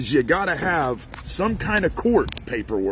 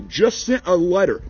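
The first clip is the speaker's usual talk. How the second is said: ordinary talk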